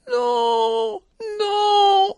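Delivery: high, crying scream of terror; terrified scream. Acted. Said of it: "elle se mit à crier". (cri de terreur aigu, en pleurs)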